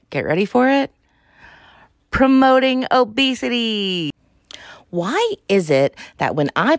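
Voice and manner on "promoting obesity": dramatic reveal. Acted. Podcaster unveiling her favorite buzzwords, dramatic. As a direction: unveil the words like a prize.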